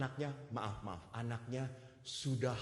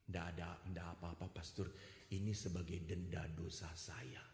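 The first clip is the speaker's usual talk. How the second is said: low, soft resigned voice; resigned quiet reply; 6 semitones lower, 7 dB quieter than usual. Retold or imitated. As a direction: accept it as penance. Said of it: "Dia ngomong apa?". (pasrah, lirih dan rendah)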